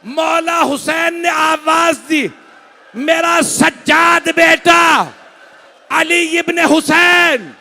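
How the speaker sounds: high, loud, slowed cries of grief; wailing lament. Acted. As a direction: preach the tragedy at full force, wailing. Climax of the masaib recitation.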